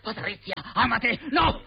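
high-pitched panicked protest; anguished outburst. Acted. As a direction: cry out in shocked disbelief. Friend learns fiancée is leaving him. (urlo angosciato)

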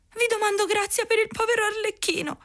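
very high, soft little puppet voice; high puppet voice asking the showman for mercy; Pinocchio. Acted. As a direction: ask for mercy in a small high voice. Pinocchio's voice in story.

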